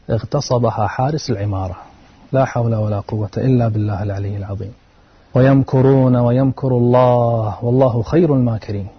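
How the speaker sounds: ordinary delivery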